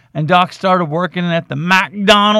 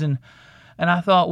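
ordinary talk, nothing marked

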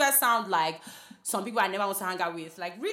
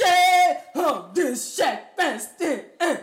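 loud laughing outburst; laughing shout; 7 semitones higher, 10 dB louder, much slower than usual. Real, not acted. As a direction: shout it while cracking up.

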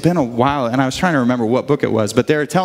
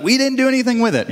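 mock kids' denial; 8 semitones higher, faster than usual. Kids deny taking lighter.

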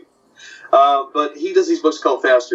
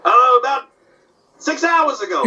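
high, casual valet answer; Rochester voice; 11 semitones higher than usual. Retold or imitated. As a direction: toss it off high and breezy. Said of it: "doing Benny and Rochester".

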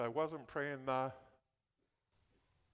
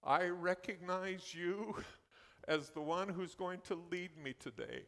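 higher, earnest prayer voice addressing the Lord; praying a psalm; 4 semitones higher than usual. Shown as a demonstration. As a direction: pray it aloud as a model for the congregation. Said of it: "and you say".

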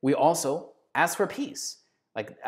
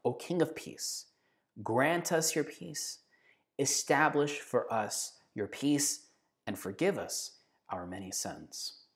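softer, slow prayerful recitation; hushed repeated prayer; 6 dB quieter, slower than usual. Acted. Repeating the liturgical prayer softly for the congregation. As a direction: pray it again, hushed and slow.

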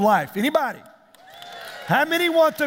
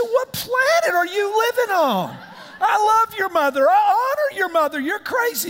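high, indignant yelling; mock outrage; 9 semitones higher than usual. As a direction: act out a defensive dad's over-the-top protest. Acts out outraged dad arguing back.

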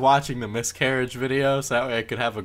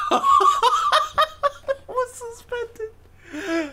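much higher, very slow laughing; much slower than usual. Real, not acted. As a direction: barely get it out through laughs. Laughing at the news.

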